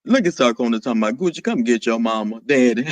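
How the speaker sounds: very low deep register. Acted. Reading Sarkona's teasing comment, deep voice. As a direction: read it way down low. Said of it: "What in the deep voice".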